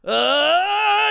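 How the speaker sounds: high, loud held cry; hammed-up moan at full force; mock climax groan. Comedian acting out the climax, mock groan. Acted climax in lewd bit.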